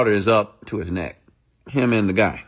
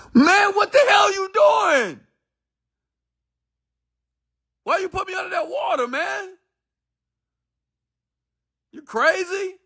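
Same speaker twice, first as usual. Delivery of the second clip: panicked crying shout. Retold or imitated. Boy surfaces after near drowning.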